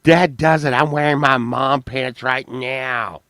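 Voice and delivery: loud mock announcement, starting high and stretching out; imitated Valerie. Predicting a friend's reaction to mom pants, loud mimicry. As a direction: call it out boldly as a mimicked quote. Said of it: "Valerie's gonna be like".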